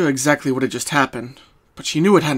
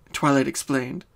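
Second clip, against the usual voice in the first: ordinary delivery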